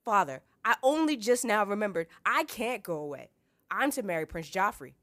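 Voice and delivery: loud, high, urgent protest; Sansa. A narrator's reading. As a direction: plead it loudly to a father. Sansa pleads with her father.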